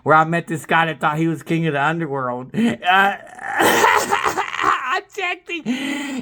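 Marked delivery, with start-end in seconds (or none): 2.5-6.2 high, drawn-out laughing cry; laugh-shout of triumph. Real, not acted. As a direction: burst out laughing as you brag. Bragging about beating him.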